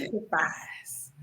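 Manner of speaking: slow breathy whisper. Real, not acted. Wife reading the verse, slow whisper. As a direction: breathe the word in awe. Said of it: "reading the key word".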